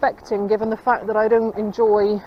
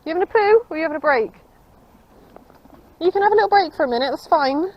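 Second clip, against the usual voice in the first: fast, high chatter to the horse; 7 semitones higher, faster than usual. Real, not acted. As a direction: rattle it off sweetly to your horse. Chatting fondly to resting horse.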